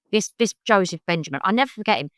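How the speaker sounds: ordinary delivery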